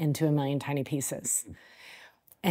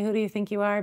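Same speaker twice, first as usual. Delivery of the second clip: mocking critic; 6 semitones higher, faster than usual. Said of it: "those people outside of us who say".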